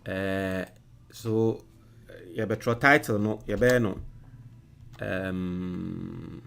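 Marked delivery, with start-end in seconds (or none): none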